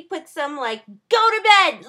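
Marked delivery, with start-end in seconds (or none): 1.1-1.9 loud, higher, scolding order; mock yell. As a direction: scold her like a tired parent. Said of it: "yell at me".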